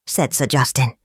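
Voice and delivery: usual speaking voice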